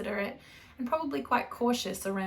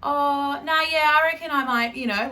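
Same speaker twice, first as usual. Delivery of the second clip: loud, bright, laughing noncommittal agreement; casual Aussie reply; 6 semitones higher, 10 dB louder than usual. Retold or imitated. Answering about the hardware store with a loud easy shrug. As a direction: toss off a vague yes with a laugh. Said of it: "Or someone would be like".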